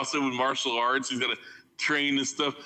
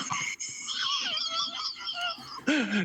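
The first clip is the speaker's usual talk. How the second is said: wheezing high laughter; 4 dB quieter than usual. Real, not acted. Collapsing into laughter.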